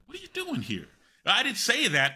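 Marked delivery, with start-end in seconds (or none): 0.1-0.9 high, softened mock-puzzled voice; baffled aside. Puzzled by the actor's weird accent, hushed high inner voice. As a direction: voice a private thought, high and hushed. Voicing his baffled private thought.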